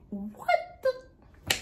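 high-pitched, cut-off disbelief; retold shock. Patient seeing her discolored leg, high shocked reaction. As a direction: squeak it out in mock horror.

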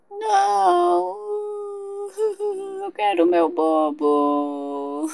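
drawn-out mock baby sobbing; whining baby. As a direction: refuse it in a long babyish wail. Pretend baby refuses the bottle. (bebê chorão fazendo manha)

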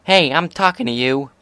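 hey, pay attention to me; angry. A narrator's reading. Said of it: "angry glare".

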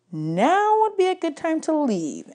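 put-on wry girl's voice urging to go; Annabeth voice. A narrator's reading. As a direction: say it pointedly, eyes on the exit.

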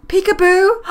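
high, loud sing-out; playful delight. Real, not acted. Player watching siblings play peekaboo, gleeful high call.

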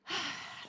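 deep breath out in a sigh; big calming sigh. Shown as a demonstration. Mummy shows Simon how she calms down, big sigh. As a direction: demonstrate a big, long sigh. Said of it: "I take a deep breath like this".

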